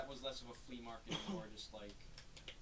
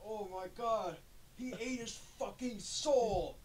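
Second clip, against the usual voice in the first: higher, louder, slow overdramatic gasp; mock anime drama; 9 semitones higher, 8 dB louder, slower than usual. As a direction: ham it up like an anime hero. Mocking melodramatic anime line.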